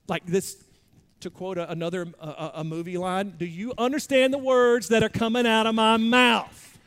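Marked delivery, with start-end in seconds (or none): 3.4-6.8 a hint of Chris Tucker, louder and exasperated; bad Chris Tucker impression. Pastor quoting a movie line, mock Chris Tucker exasperation. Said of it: "That was a really bad Chris Tucker impression".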